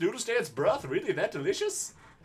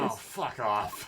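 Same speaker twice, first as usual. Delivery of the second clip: low, slow, fed-up; deep slow groan; 8 semitones lower, much slower than usual. Real, not acted. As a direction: drag it out low and annoyed.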